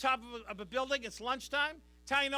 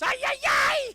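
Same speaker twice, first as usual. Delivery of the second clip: very high, loud comic shriek; mock despairing yell; 8 dB louder than usual. Mexican worker jumping off building over tacos, hammed-up high yell. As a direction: cry out in hammed-up horror.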